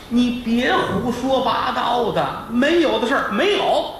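high, drawn-out protest; lightly voiced distraught wife; wife denying. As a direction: plead your innocence. Wife denies accusation.

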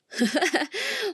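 a sudden high, loud burst of laughter. Real, not acted. Telling a friend story, bursting into high loud laughter.

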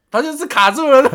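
laughing through the words, voice high and loud; cracking up while telling how he froze. Real, not acted. Telling her friend how the salesman froze, laughing loudly.

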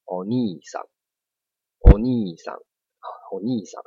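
お兄さん with に held long; Japanese long vowel. Shown as a demonstration. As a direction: repeat お兄さん, stretching に. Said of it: "大家知道这个".

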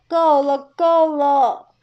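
大声喊够了; 用力喝止; 大声喊停. Acted. 奶奶喊停. (大声喝止)

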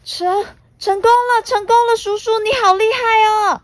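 high, fast, delighted squeal; excited child cheer. Acted. Puna cheering her uncle's success, fast and high. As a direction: cheer like a thrilled little girl.

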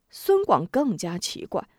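usual speaking voice

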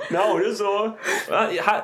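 laughing too hard to go on; talking through laughter. Real, not acted. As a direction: try to speak while still cracking up. Still laughing while trying to talk.

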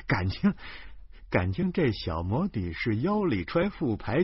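usual speaking voice

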